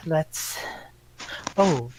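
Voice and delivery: ordinary talk, nothing marked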